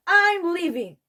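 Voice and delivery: high, loud declaration; announcing. A narrator's reading. Peter announcing to his parents he is leaving, loud declaration. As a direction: proclaim it to the whole kitchen. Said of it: "I announced".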